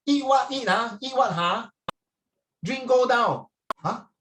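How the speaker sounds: high, loud Singlish challenge; Singlish demo. Shown as a demonstration. Teacher demonstrating Singlish phrases to the class, punchy Singlish. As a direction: exaggerate the Singlish questioning tune. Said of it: "Very Singlish".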